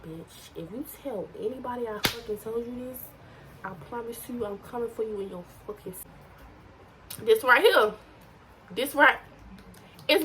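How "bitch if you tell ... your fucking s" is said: menacing whisper. Pretend. Mock threat to viewers.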